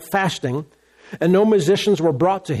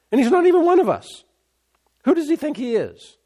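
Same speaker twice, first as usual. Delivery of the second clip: jealous grumbling; 9 semitones higher than usual. Acted. Jealous officials grumbling about Daniel.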